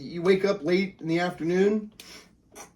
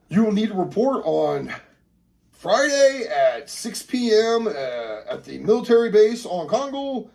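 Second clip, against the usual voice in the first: proud letter reading; 6 semitones higher, 5 dB louder than usual. Acted. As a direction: read it out grandly to the whole family. Dad reading letter aloud to family.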